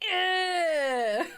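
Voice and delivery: a high, laughing dismissive grunt; mock disdain. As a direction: brush it off with a scoff. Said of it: "we're like".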